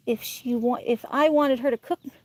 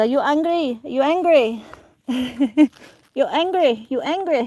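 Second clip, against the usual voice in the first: mild imitation of an Italian grandmother's broken English, asking again and again; lightly mimicking an Italian nana's repeated question; Nana's Italian accent; 3 semitones higher, 4 dB louder than usual. Grandmother asking the child over and over, in light Italian accent. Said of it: "she kept asking me if I was angry".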